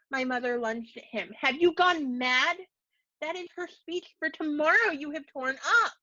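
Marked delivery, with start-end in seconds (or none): 1.3-5.9 outraged cry. A narrator's reading. Mother confronts father tearing daughter's speech, high outraged cry. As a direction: hurl the question at him.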